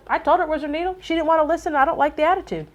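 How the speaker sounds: high-pitched mock grumbling; annoyed technician. Retelling Brian's annoyed complaint about customer.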